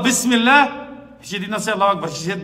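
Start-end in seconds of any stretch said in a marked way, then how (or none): 0.0-0.8 raised, ringing Bismillah again; son's last word. Retold or imitated. Imam repeating the son's last word, raised. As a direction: proclaim it the same way. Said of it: "He mentioned the name of Allah".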